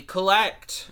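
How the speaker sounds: called out high and loud; dramatic callout. Acted. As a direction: announce the move name with flair. Announcing card ability name.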